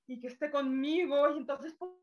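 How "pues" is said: ordinary delivery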